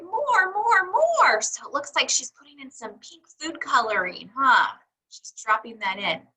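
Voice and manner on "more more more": higher, insistent more, more; a child pleading for extra, pitched up; eager crying out. Acted. Pinkalicious urging Mommy to add more pink, eager and high. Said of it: "I cried".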